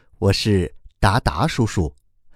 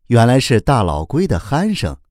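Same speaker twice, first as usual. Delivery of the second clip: ordinary delivery, nothing marked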